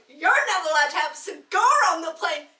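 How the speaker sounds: loud mock-furious comeback; furious woman snapping back at the captain; angry retort. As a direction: throw his rule back at him. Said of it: "so mad, goes".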